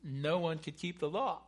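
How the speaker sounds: ordinary talk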